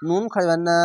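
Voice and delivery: usual speaking voice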